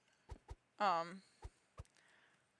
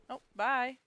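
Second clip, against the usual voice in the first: ordinary delivery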